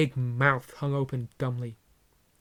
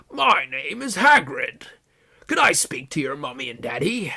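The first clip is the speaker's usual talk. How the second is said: Hagrid voice; 5 dB louder than usual. Acted. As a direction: boom out a cheerful hello. Hagrid introduces himself.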